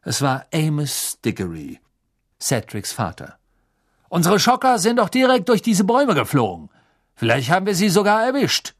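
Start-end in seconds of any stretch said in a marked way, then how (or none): 4.1-8.7 higher, louder, sure of himself; confident wizard. Acted. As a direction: insist the spell hit home. Diggory insists on success.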